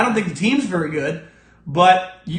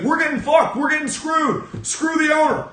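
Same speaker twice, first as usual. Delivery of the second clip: higher, shouted complaint; mock whiny outrage; 6 semitones higher than usual. Host mocking players complaining about owners, higher mock outrage. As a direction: ham up the players' griping.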